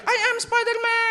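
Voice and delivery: put-on very high little-boy cry; child's shout. Shown as a demonstration. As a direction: demonstrate a toddler's superhero yell. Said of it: "Coba teriak".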